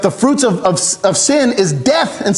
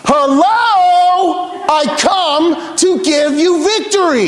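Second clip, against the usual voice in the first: high, drawn-out proclamation; God hammering the point home, pitched right up; emphatic calling out; 8 semitones higher, much slower than usual. Acted. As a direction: call it out slowly as if to wake them up. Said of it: "It's almost like God is emphasizing".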